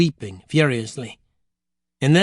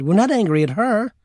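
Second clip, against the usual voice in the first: mildly indignant objection, pitched up; objecting; 6 semitones higher than usual. Acted. Jorinda correcting the grandmother, objecting. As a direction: push back with a higher voice. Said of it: "Jorinda objected".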